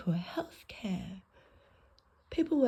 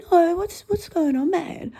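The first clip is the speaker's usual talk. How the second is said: retold loud concern; 9 semitones higher, 6 dB louder than usual. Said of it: "And I'm like".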